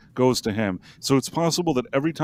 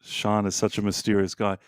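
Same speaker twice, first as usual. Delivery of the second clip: ordinary talk, nothing marked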